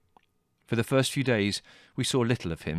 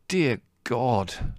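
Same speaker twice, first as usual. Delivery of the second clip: higher-pitched exasperated groan; 4 semitones higher than usual. A narrator's reading. Larry hearing the hated record at breakfast, groaning. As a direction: groan in weary dismay. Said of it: "Larry groaned at breakfast".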